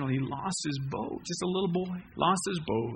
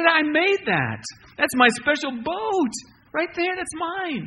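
put-on much higher and louder, thrilled child's cry; a small boy spotting his lost treasure; excited little boy; 11 semitones higher, 7 dB louder than usual. Boy to store owner, high excited child claiming his boat.

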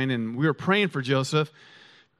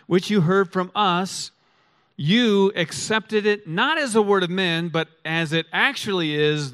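solemn scripture reading; 3 semitones higher than usual. Acted. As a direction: lift the scripture up slowly, word by word. Pastor reads scripture aloud.

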